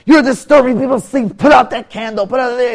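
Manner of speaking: high, loud scolding shout; acted screaming innkeeper; angry screaming. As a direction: yell at a guest keeping everyone awake. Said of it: "He starts screaming at him".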